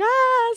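a kid's frightened shriek retold; retold scream. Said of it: "They're like".

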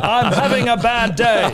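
high, loud, slow crier laughing through it; town crier. Pretend. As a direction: bellow your bad news to the square. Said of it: "the hear ye guy".

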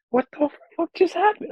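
reliving the shock of the match; incredulous retold outburst. Fan retelling his reaction to Dortmund, incredulous high outburst.

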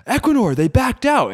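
excited shout telling coworkers the win. Retold or imitated. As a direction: shout the news to the team. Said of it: "I was like".

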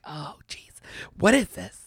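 disgusted whisper. Retold or imitated. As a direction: whisper it in horror. Retold disgust on touching floor.

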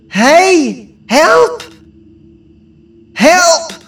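loud high shouts for help; calling for help. Acted. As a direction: yell out desperately for help. Lost girl calls for help.

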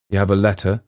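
ordinary delivery

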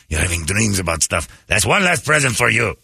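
put-on scolding elf in a French accent. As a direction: pass judgment in a mock French accent. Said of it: "French accent".

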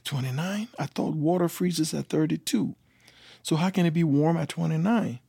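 high-pitched incredulous questioning; acted bafflement, pitched well up; retold disbelief. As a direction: question it as if it makes no sense. Retelling his disbelief at the temperature.

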